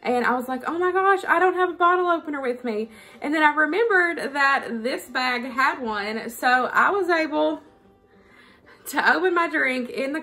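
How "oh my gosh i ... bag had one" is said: usual speaking voice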